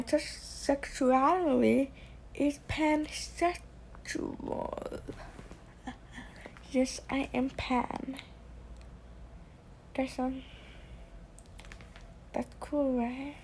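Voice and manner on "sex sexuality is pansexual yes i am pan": shy whisper. Real, not acted. Shy reveal to viewers.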